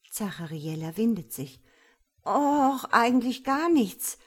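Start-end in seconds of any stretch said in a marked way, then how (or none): 2.2-4.2 stretched-out, higher and louder 'Och'; drawn out. A narrator's reading. Zachariella squirms before God, drawn out. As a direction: draw it out, squirming out of answering. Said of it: "sagt sie gedehnt".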